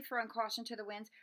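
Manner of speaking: ordinary delivery, nothing marked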